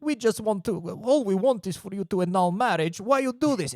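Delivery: put-on high-pitched Russian-accented pleading; pleading Russian gangster. Gangster trying to stop her smashing things, pleading Russian accent. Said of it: "sort of trying to corral her to stop".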